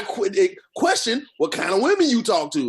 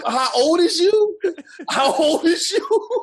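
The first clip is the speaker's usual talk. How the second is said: laughing teasing; 5 semitones higher, slower than usual. Real, not acted. Teasing friend mid-laugh.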